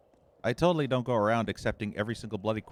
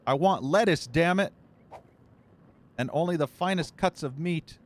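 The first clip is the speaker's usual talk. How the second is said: hammed-up demanding yell, higher and louder; mock yelling husband; 7 semitones higher, 4 dB louder than usual. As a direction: shout his demands like a spoiled tyrant. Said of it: "And he's like".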